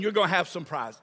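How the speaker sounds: usual speaking voice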